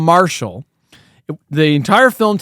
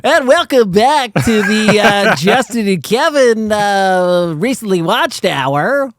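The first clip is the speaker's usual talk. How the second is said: slow, higher radio host voice; radio showmanship; 5 semitones higher, slower than usual. Pretend. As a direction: welcome listeners like a DJ. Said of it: "you try to give it that, like, radio showmanship".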